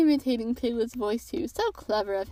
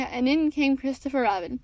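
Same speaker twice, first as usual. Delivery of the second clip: ordinary delivery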